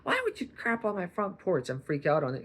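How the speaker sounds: high, scolding squeal at the bird; hammed-up outrage at a pooping chicken; mock freak-out at a chicken. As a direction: overreact like the chicken wronged you. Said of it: "you're not gonna be like".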